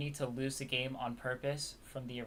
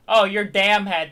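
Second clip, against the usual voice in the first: slow, loud, higher mock shout; mock yell; 6 semitones higher, 16 dB louder, slower than usual. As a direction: drag it out in a loud mocking yell. Mocking the game's insult loudly.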